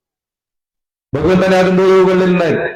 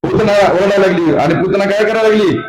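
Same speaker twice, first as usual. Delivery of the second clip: ordinary talk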